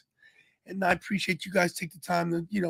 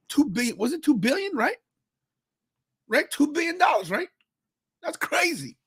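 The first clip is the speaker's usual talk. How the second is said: amazed disbelief; 9 semitones higher than usual. Real, not acted. Marvelling at a huge lottery win.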